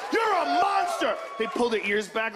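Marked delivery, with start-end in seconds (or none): 0.1-1.2 hammed-up yell; a mock scream at the cat. Owner yelling at the cat, in a mild mock yell. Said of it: "You scream at 'em".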